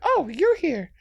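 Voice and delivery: Karen quote. Retold or imitated. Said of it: "she's like".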